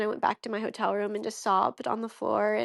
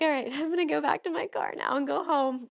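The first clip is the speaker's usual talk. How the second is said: spooked; 5 semitones higher, faster than usual. Retold or imitated. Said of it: "super spooked".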